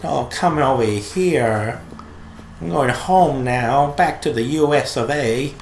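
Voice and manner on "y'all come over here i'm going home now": put-on slow, stretched Southern American accent; lazy Southern American drawl. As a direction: drag each word like a Southern belle. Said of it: "Southern American belle".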